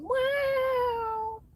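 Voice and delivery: squeaky mock goodbye; falsetto goofball. Silly high voice.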